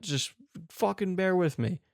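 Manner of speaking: normal talking voice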